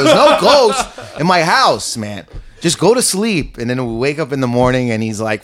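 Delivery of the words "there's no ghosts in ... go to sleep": high, drawn-out exasperated protest; retold exasperation. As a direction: argue him down, stretching the words, pitch up. Retold argument with frightened friend.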